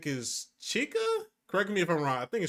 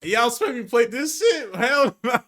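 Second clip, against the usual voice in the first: loud, high-pitched protest breaking into laughter; real, half-laughing outburst; incredulous refusal; 7 semitones higher, 6 dB louder than usual. Real, not acted. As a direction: refuse loudly in amused disbelief. Refusing the scary game, half laughing.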